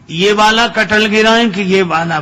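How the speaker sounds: loud, high shout from the treetop; very loud call. Acted. Madhavdas shouts down from the tree, very loud and high. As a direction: bellow the question down from the tree. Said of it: "बड़े जोर से बोले".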